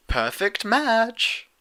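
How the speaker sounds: high, slow sing-song. Acted. Rarity countering Twilight's jab, slow sing-song. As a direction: lilt it smugly, drawn out. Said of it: "in a sing-song voice".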